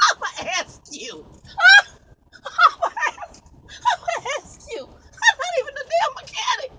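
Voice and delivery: squealing high through laughter; helpless laughter. Real, not acted. Trucker retelling being asked about repairs, laughing helplessly. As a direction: retell it while cracking up, voice shooting way up. Cracking up while retelling the shipper exchange.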